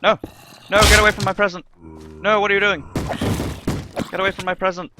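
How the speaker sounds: high, loud panicked yells; panicked shouting. Acted. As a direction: shout to drive them away. Zombies wreck his present.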